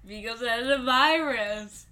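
imitating a friend's answer; Caden's voice. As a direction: parrot his answer back, mimicking. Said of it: "you sound like".

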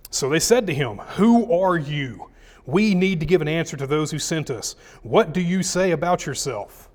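loud, declaiming Bible reading; scripture reading. Acted. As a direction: proclaim the verses to the room. Reads scripture aloud to congregation.

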